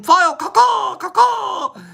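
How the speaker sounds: high loud caw-caw like a hawk; hawk caw call. Pretend. Streamer cawing at a viewer named Two Hawks, high and loud. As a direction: squawk it out like a bird.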